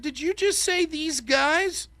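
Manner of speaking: high, slow, incredulous challenge; mock affront. Debater pouncing in cross-ex, mock affronted challenge. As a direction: draw it out as if deeply offended.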